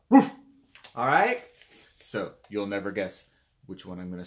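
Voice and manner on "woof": one short woof; dog bark. Shown as a demonstration. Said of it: "bark like a dog".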